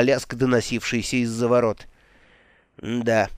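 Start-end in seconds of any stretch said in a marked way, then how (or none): 2.8-3.3 low, uneasy murmur; a touch of dread, kept small; trembling voice. A narrator's reading. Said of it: "дрожащим голосом сказал он". (слегка дрожащим голосом)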